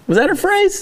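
squeaky pupil asking a question; mock high student voice. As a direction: ask like a naive schoolkid.

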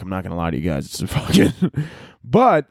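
usual speaking voice

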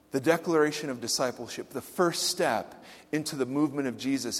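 normal talking voice

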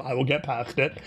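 ordinary talk, nothing marked

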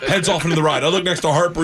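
ordinary talk

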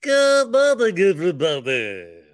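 booming drawn-out good morning; radio host greeting. Retold or imitated. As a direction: belt it like a morning DJ. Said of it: "sounded like me".

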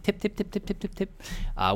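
high, soft tip tip of heels; mock heel clicks. Retelling the waitress walking over, mimicking her high heels clicking. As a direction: tap out her dainty steps in a high little voice.

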